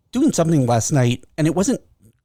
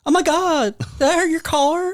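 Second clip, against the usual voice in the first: high, squeaky Southern ditz; wound-up little Southern voice, apologetic; 12 semitones higher than usual. Acted. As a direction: gush in a high Southern accent. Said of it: "That's [name]'s voice".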